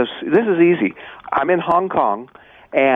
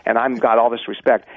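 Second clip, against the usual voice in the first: normal talking voice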